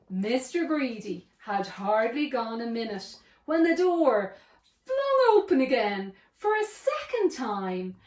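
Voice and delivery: ordinary delivery, nothing marked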